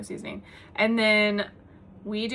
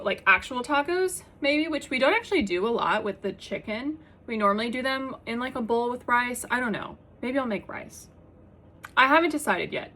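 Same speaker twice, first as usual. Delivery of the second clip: ordinary talk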